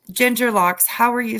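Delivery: ordinary talk, nothing marked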